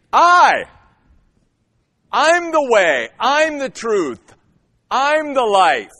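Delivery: "I" hit high and loud, then slow; "I" punched hard, the rest slow and deliberate; emphatic I. Shown as a demonstration. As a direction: stress each "I'm" in "I'm the way, I'm the truth, I'm the life". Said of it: "is emphatic".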